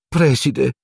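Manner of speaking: high, mildly spluttered protest; object, caught off guard; spluttering. A narrator's reading. Nurse protesting to the headmaster, spluttering. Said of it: "farfugliò Madame Pomfrey".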